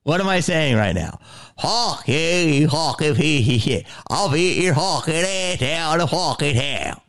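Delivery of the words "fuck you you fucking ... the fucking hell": high, slow, hammed-up Delco threat; mock Delco tirade with stretched vowels; Delco accent. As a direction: rant in an exaggerated Philly Delco accent. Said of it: "That's how I grew up".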